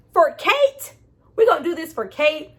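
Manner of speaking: high, loud, disbelieving question; incredulous. Retold or imitated. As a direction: scoff it in disbelief. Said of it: "I'm like".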